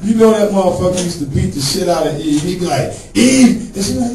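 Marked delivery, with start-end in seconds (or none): 3.1-3.7 high, loud yell of her name; bellowing husband. Retold or imitated. Archie yelling for wife.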